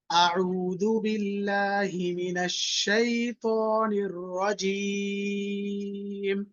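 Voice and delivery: slow, melodic tajweed recitation with a long held final vowel; measured chanting recitation, the last word drawn out; Quranic recitation. Shown as a demonstration. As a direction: recite it slowly for the class to echo. Teacher recites for class to repeat.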